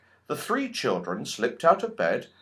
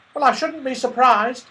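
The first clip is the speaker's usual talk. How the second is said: much higher, quick little squirrel voice; tiny squirrel voice; 12 semitones higher, 5 dB louder than usual. Acted. The red squirrel warns Joe the goblins took everyone, tiny and quick. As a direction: chatter like a tiny worried squirrel.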